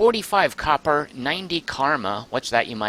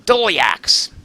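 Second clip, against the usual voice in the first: sudden loud, higher shout of recognition; triumphant yell as the name comes back; excited yell; 7 semitones higher, 8 dB louder than usual. Real, not acted. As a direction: blurt it out loud, right in someone's ear. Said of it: "I yelled in your ear".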